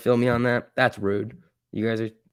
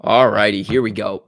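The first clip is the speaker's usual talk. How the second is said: much louder call to the stream; loud all righty; 9 dB louder than usual. Real, not acted. Streamer calling chat back to the trading, loud all righty.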